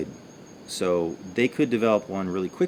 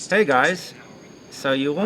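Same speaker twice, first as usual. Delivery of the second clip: raised, louder ventriloquist act; 5 semitones higher, 4 dB louder, slower than usual. Pretend. Streamer hiding the video delay from viewers, mock ventriloquist. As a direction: put on a ventriloquist's voice. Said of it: "we just ventriloquist".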